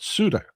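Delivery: "Suda" in two syllables, SOO-duh; English-style "Suda", no "e" sound in the middle; anglicized pronunciation. Shown as a demonstration. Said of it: "we anglified it and call it".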